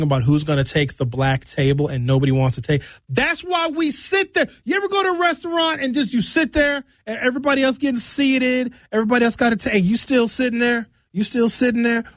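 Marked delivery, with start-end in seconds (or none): 3.1-4.5 mock whine. Mocking slow table scene. 4.6-12.0 incredulous rant. Acted. Ranting about waiting in restaurant.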